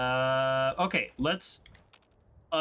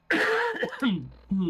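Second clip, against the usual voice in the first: harsh hacking cough; coughing fit; 3 dB louder than usual. Real, not acted. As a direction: cough hard into the mic. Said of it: "I sound like a smoker".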